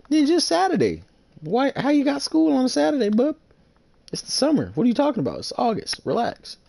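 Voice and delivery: high-pitched, incredulous ribbing; squeaky-high trash talk; mocking disbelief. Real, not acted. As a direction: call out a lame excuse, voice shooting way up. Mocks an opponent's school excuse.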